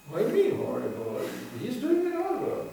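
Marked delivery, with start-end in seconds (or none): 1.6-2.7 mock reply. Mocking reply in another voice.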